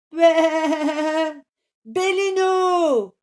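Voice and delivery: very high, loud, drawn-out call; joyful cry. Acted. Rooster hears his kidnapped friend, high calling cry.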